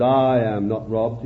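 low, calm reply; voice of the Lord. Retold or imitated. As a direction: answer as the Lord, low and assured. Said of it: "The Lord says".

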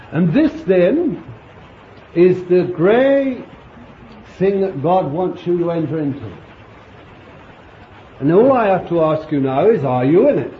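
fervent sermon voice, drawn out and loud; impassioned preaching. Acted. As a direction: preach it with full conviction. Preaching at full force.

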